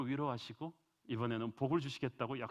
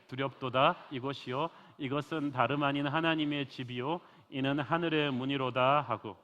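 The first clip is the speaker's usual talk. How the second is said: loud, slow proclaiming of the verses; solemn scripture reading; 6 dB louder than usual. Acted. Preacher reads Genesis to the congregation, loud and slow. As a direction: declaim God's promise from the pulpit.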